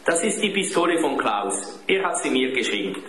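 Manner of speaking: lightly voiced cheek; cheeky boy. A narrator's reading. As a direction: answer back as an impudent boy. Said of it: "Dieter sagte frech".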